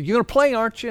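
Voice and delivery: high, loud excited urging; eager pressing. Acted. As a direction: push him to say yes, loud and high. Friend eagerly presses golf invite.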